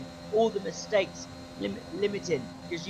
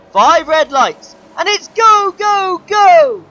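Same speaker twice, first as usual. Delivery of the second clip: loud, high cry, drawn out then bursting; race start shout; 9 semitones higher, 14 dB louder, slower than usual. Real, not acted. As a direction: hype the lights-out start.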